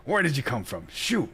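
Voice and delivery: startled shout, pitch jumping up. Acted. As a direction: caught off guard, yelp the last word. Miller startled, swearing.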